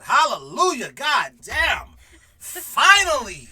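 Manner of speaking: very loud, high hallelujah cry; mock jubilant shout. Mom once her son moved out, mock jubilant shout. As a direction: shout it out in hammed-up relief.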